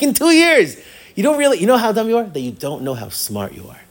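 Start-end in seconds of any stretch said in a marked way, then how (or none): 0.0-2.2 high, loud exclamation with laughter; amused exasperation. Retold or imitated. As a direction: exclaim incredulously through a laugh. Rebbe exclaims at student, amused.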